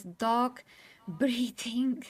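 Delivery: slow, breaking into a laugh mid-phrase; laughing while emotional. Real, not acted. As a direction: laugh through the words, slow. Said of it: "I'm really emotional about this".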